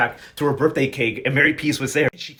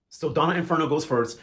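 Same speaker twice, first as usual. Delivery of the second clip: ordinary delivery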